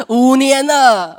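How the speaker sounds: slow, loud, stretched out; long-suffering slow exclamation; drawn-out exasperation. Real, not acted. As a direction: drag out the years in disbelief.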